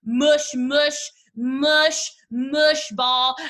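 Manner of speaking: hammed-up mush. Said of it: "I am a mush".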